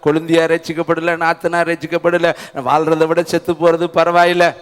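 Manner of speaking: a hint of weary self-pity; mock lament. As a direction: imitate a believer whining about troubles. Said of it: "இந்த வருஷம் பூரா ... புலம்புனீங்க".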